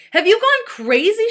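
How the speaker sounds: high, loud frantic shout. A narrator's reading. Allison yelling at a class that can't see her, loud shout. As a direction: hurl it at the whole class. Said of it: "shouted Allison".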